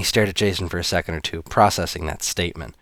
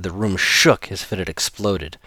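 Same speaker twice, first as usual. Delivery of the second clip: usual speaking voice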